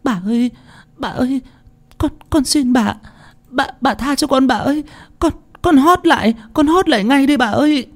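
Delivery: put-on high, stammering child's plea; frightened little maid pleading; desperate begging. A narrator's reading. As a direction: implore in a small, terrified high voice. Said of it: "van xin".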